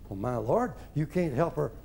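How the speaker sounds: ordinary delivery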